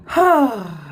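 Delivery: long, audible falling sigh; heavy voiced exhale; exasperated sigh. Real, not acted. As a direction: let out a big fed-up sigh. Exasperated after the argument.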